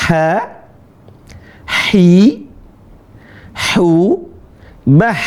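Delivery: "Ha, hi, hu, bah" with a thin, airy throat h; ha yang tipis. Shown as a demonstration. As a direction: push air through the throat on each h. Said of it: "yang tipis". ("Ha, hi, hu, bah" dengan ha tipis dari tenggorokan)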